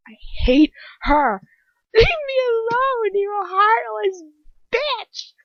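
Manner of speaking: high, loud, anguished scream. A narrator's reading. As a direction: scream it out in hatred. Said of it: "I scream".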